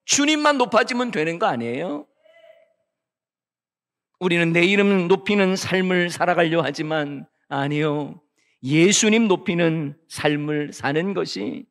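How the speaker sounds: normal talking voice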